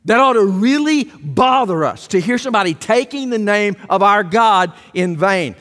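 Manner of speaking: ordinary talk, nothing marked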